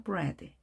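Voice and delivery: 브레드 as English bread, short e; 브레드 softly, with English br and short e; short vowel. Shown as a demonstration. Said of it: "짧게 발음합니다".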